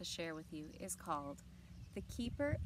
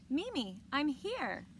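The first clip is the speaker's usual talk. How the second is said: calling out eagerly, a bit higher and louder; excited call; 7 semitones higher, 6 dB louder, slower than usual. Acted. Brooke calling to her grandmother as she arrives, excited. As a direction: announce your arrival with joy.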